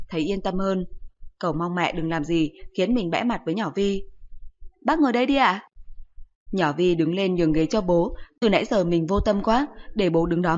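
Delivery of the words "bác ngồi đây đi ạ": put-on higher, louder sweet girl voice; polite young girl. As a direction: greet an elder like a well-mannered schoolgirl. Girl offers seat politely.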